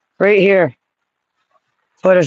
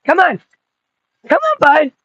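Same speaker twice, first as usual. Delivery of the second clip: high, sing-song call to a dog; high coaxing call to the dog; 8 semitones higher than usual. Real, not acted. Calling the dog over.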